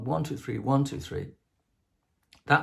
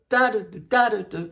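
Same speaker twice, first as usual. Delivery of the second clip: higher, louder accented das; accented scat; 9 semitones higher, 6 dB louder than usual. Shown as a demonstration. Composer demonstrating accented notes to students. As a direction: hit each da with an accent.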